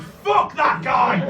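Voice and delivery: loud, slow mock shout of outrage; furious friend yelling fuck that guy; outraged shout. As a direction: bellow it like an indignant best friend. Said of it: "my best friend heard that and went".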